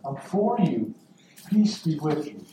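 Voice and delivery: soft, intimate reassurance; hushed words of love to one listener; Jesus. Acted. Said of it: "He's saying".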